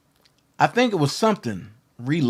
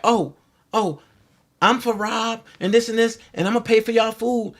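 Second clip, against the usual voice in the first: lightly imitating her, pitched higher; mock eager introduction; 6 semitones higher than usual. Glenda introducing herself at the meal, mock eager. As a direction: pitch it up to mimic an overeager introduction.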